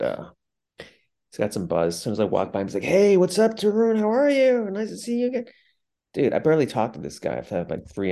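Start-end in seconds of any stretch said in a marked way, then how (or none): 2.8-5.5 imitating an over-eager schmoozer; fake-friendly greeting. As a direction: gush with phony delight at seeing them.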